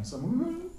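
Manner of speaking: high wailing sob, half laughing; mock a lady's crying wail; playful high-pitched fake weeping; imitated crying. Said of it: "Ladies know how ladies cry".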